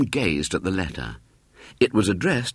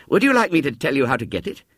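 put-on quick, higher boyish pitch; scheming boy; 6 semitones higher, faster than usual. Boy pitching his plan to William, quick and higher. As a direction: rattle off the offer.

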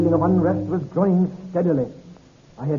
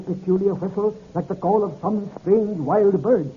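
ordinary talk

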